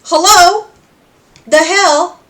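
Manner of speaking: high, loud irritated call; annoyed hello. Retold or imitated. Cherry calling out to Pentious, high and irritated. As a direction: snap at him in disbelief. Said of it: "she's like".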